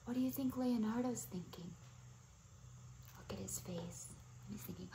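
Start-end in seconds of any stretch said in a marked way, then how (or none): 3.2-5.0 soft quick unvoiced whisper; hushed aside. Real, not acted. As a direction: whisper to draw attention. Pointing to child.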